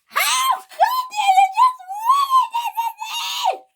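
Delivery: slow, very high wailing shriek; screaming. A narrator's reading. Millie blaming Luke for the accident, screaming. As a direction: scream the accusation, stretching the words. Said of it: "She was screaming at Luke".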